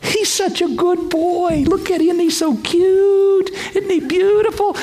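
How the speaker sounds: put-on high, slow cooing over a cute child; proud doting God. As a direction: coo like a proud parent showing off baby photos. God bragging like a proud parent.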